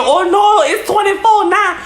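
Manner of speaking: high-pitched calling out from the back; mock shout of a lady upping the price; imitated background lady. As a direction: holler it high, as if across a store. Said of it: "hear the lady in the background saying".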